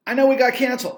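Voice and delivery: insistent second take. Real, not acted. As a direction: hammer "know" in the repeat.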